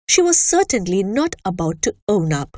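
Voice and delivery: ordinary delivery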